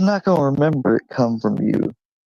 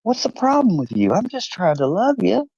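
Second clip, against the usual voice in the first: higher, lightly voiced hurt puppy; dog's hurt thought; 10 semitones higher than usual. Dog Brownie puzzled by the cat, lightly hurt. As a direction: play the confused dog gently. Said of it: "he's got his head turned like".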